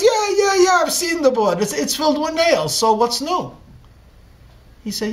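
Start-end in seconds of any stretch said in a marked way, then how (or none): 0.0-3.6 high, loud, mocking brush-off; whiny teenage eye-roll, much higher and louder; dismissive teen. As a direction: toss it off like a bored brat. Obstinate son brushes off father.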